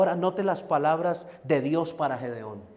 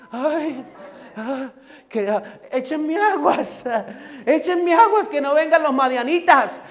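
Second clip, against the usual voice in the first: hammed-up panicky cry, higher, with a laugh; mock frightened wail; 10 semitones higher, 8 dB louder than usual. Preacher mocking Gideon hiding in fear, hammed-up panicky wail. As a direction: play the cowering coward for laughs.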